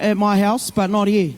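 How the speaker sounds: normal talking voice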